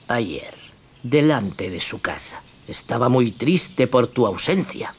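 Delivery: low, soft, sly fox voice; a hushed, crafty greeting. Acted. The fox greets Pinocchio, low, soft and sly.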